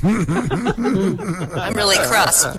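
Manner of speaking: words drawn out, very slow, through a laugh; cracking up at her own punchline. Real, not acted. Teller to her friends, cracking up at the pun.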